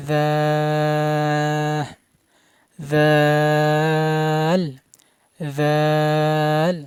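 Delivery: tongue tip to teeth, hold "জাল" long without puffing air; thin letter, elongated. Shown as a demonstration. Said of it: "কোনো বাতাস বের হবে না". ("জাল" পাতলা করে লম্বা টানে)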